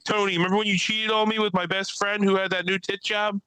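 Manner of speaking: high-pitched imitation of her; imitated woman comedian. Woman comic calling out her ex in the audience, imitated. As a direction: put on her voice, pitched well up. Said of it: "She's like".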